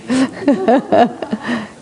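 short real laugh at the quote. Real, not acted. Retelling the strong man's reply, a real laugh. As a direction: laugh at the big man's line.

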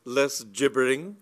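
loud, cutting put-down; cold command. A narrator's reading. Lady Bassett silencing Cyril's babble, loud and cold. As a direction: cut through his babble coldly. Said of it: "cried Lady Bassett coldly".

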